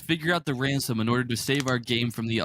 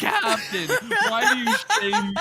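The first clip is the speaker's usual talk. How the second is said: high giggly laughing speech; words squeezed out through laughter; laughing hard. Real, not acted. Laughing hard after anime voice.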